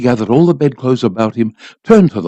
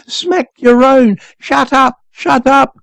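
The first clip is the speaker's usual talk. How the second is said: much higher, slow and drawn out; hysterical scream; 11 semitones higher, slower than usual. A narrator's reading. The boy screaming again and again at his father, high. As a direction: keep shrieking it at him. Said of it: "I screamed hysterically".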